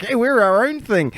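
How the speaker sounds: high-pitched put-on protest; mock insistence. Mocking an islander claim again.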